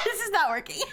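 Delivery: said through laughter; laughing. Real, not acted. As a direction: laugh while you say it. Laughing at bad AI accent.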